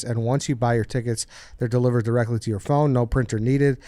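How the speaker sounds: upbeat sponsor read. Acted. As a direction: read it like a podcast ad. Reads the sponsor ad.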